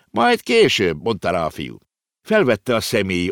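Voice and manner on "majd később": much higher, brisk brush-off; dismissive boy. A narrator's reading. The clerk boy brushes Konrád off: dismissive. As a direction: wave it off.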